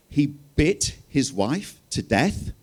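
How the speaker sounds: "bit" said short, like bite, asked high and slow; American Marie. Retold or imitated. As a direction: play Marie mishearing "beat" as "bit", high and drawn out. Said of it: "lovely American Marie".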